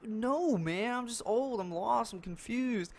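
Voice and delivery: high, helpless mock whine; high-pitched imitation of a confused older driver; imitated old guy. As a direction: mimic a befuddled old man, pitch way up. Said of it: "he's this old guy".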